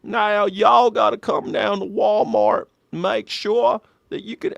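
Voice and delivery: slow, high soft Arkansas drawl; Arkansas accent. Retold or imitated. Comedian doing Clinton in soft Arkansas accent. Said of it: "soft Arkansas accent".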